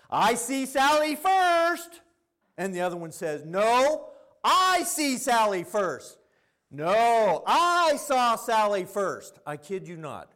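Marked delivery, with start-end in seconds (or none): 0.1-2.0 put-on high, drawn-out childish yell; kindergartner piping up. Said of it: "one of them pipes up". 3.4-9.4 high, drawn-out squabbling yell; escalating kindergartner shouting match, imitated; kids screaming at each other. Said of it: "they're screaming at each other".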